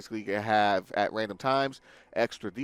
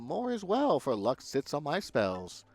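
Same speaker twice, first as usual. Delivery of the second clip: high sing-song mystic voice; fortune teller; 7 semitones higher than usual. Acted. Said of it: "this is a fortune-telling shop".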